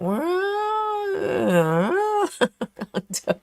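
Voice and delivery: high drawn-out mock yowl; imitating cat yowl. Mimicking the neighbour cat's yowl for viewers. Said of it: "He, he would go like".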